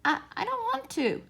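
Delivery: high, faltering little rabbit voice; hesitant little rabbit. A narrator's reading. Toy rabbit nervously refusing the furry rabbit, high and hesitant. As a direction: stammer it out, shy and small. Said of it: "answered the Velveteen Rabbit".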